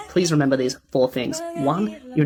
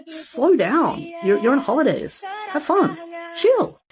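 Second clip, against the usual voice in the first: excited; 12 semitones higher, slower than usual. Real, not acted. Excited tip for tourists.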